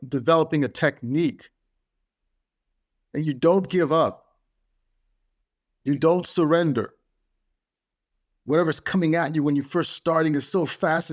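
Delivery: ordinary talk, nothing marked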